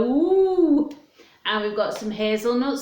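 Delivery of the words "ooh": high, loud admiring ooh; mums going ooh. Retold or imitated. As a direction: coo like impressed mums. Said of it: "all the mums will be go".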